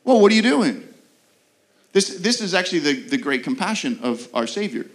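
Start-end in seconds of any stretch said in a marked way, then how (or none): 0.0-0.9 a loud startled whoa; mock-shocked branch yelling at the gardener; startled cry. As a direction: jolt back in alarm, louder. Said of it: "We're like".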